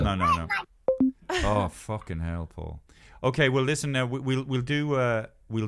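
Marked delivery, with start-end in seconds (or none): 0.0-2.8 low, slow, weary no; exasperated groan. Real, not acted. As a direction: drag it out in disbelief. Exasperated at a friend.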